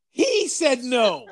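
high-pitched, aggrieved mock protest; indignant protest. Retelling his answer to the bum, indignant.

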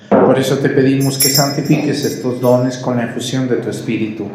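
ordinary talk, nothing marked

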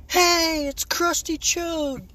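high, loud, drawn-out cheer; crowd hailing hero. Acted. Cafeteria kids hail wrestler bursting in, hollered cheer. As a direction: holler it like a whole room greeting him. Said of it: "and everybody's like".

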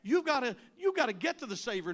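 ordinary talk, nothing marked

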